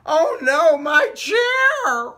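high, loud oh no; squeaky dismayed character voice; dismayed cry. Acted. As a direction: wail over the broken chair. Character dismay at broken chair.

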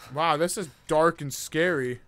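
normal talking voice

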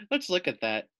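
ordinary talk, nothing marked

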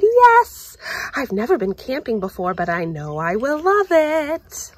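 high, loud and eager, tumbling out fast; excited yes. Acted. Girl thrilled to go camping, high and eager. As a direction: burst out with glee at going camping. Child quoting her own excitement.

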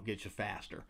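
ordinary delivery

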